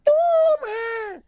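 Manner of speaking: very high shouted whine; whiny teen. Retold or imitated. Nephew complaining about the chores, high whiny shout. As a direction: groan like a sulky teenager.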